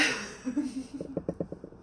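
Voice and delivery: breathy burst of laughter. Real, not acted. Author amused mid-pitch to her viewers, breathy laugh. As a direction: let a voiceless giggle escape. Reacting while talking about her books.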